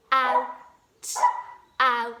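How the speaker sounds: loud, slow sounding out of out. Shown as a demonstration. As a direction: say each sound clearly and loudly. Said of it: "Out has got two sounds".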